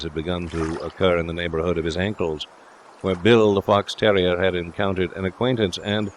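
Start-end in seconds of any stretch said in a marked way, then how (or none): none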